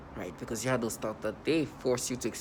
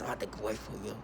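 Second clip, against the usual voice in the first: softer, lower mock menacing voice; menacing voice imitation; 4 semitones lower, 9 dB quieter, faster than usual. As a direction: imitate their creepy menacing tone, held back. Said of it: "use this menacing voice".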